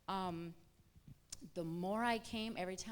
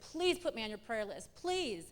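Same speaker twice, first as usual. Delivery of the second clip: pleading; 5 dB louder than usual. Retold or imitated. Asking Christy for prayer, pleading. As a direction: beg a friend, pushing harder on the last word.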